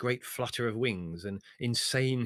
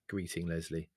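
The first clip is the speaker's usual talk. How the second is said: plain reading voice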